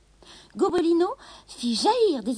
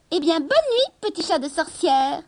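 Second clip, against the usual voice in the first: sneering; 5 semitones higher, 6 dB louder than usual. A narrator's reading. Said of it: "ricana-t-il enfin".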